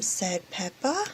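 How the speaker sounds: plain reading voice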